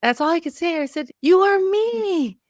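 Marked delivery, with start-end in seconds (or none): 1.2-2.4 high, slow, drawn-out reveal; young self told who she is, slowly; earnest reveal. Retold or imitated. Tells young self the truth.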